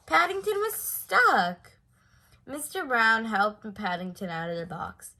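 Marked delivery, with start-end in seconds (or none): 0.1-1.7 high, loud, slow comic dismay. A narrator's reading. Narrator revealing the bear is stuck, comic dismay. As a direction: reveal the mishap with a gasp.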